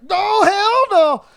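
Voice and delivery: high, very loud, drawn-out refusal; outraged shout. Retold or imitated. Housemate reliving the sight of him heading off, outraged shout. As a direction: yell it in outrage, stretching it out. Acting own outraged reaction in story.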